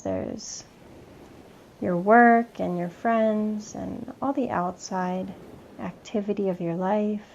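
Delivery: measured meditation voice with long pauses; unhurried meditation leader, letting each phrase settle; guided meditation. Acted. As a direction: guide them gently inward, leaving space between phrases.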